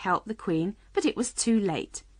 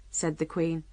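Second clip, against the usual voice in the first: usual speaking voice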